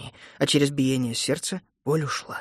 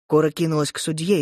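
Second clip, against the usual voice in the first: ordinary delivery, nothing marked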